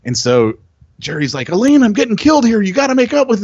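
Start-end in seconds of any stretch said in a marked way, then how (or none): 1.5-3.4 high, fast and loud, begging in a panic; frantic Jerry. Retold or imitated. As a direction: whine it like a panicked buyer being squeezed. Panicked Jerry voiced from Seinfeld.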